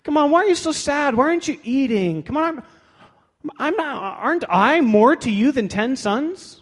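high-pitched, incredulous husband's whine; mock pleading husband. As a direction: plead like a clueless, hurt husband, hammed up. Acts out the clueless pleading husband.

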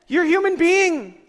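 high, full-force preacher's yell; loud, high-pitched exclaiming; emphatic shout. Real, not acted. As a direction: holler the point out to the whole room. Preacher yells his key point.

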